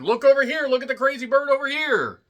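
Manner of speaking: high, loud look-at-me call; mock attention call. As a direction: shout over the room to be noticed.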